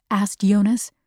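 plain reading voice

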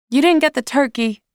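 mother crying out over the phone. A narrator's reading. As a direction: cry it out high and hurried. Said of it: "Mom cries into the phone".

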